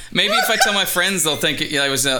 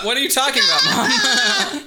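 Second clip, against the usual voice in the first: mock child voice; 10 semitones higher than usual. Child voice in cheese skit.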